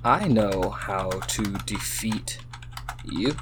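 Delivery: slow, soft, word by word; typing aloud. Real, not acted. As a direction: murmur it slowly as you type. Saying words while typing them.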